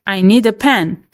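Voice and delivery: normal talking voice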